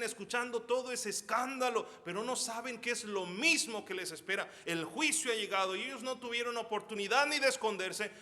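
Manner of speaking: rising, driven storytelling voice; urgent preaching narration. Acted. Preacher narrates soldiers bursting in, urgent. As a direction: tell the massacre with mounting urgency.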